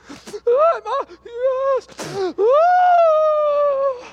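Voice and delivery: very high, loud mock crying; hammed-up blubbering bridegroom; squealing, sobbing groom. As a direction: squeak out the words, then wail. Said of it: "he was a squealer".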